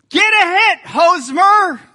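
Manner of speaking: high, loud stadium yell. Retold or imitated. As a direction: belt it across the ballpark. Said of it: "I yell out at the top of my voice".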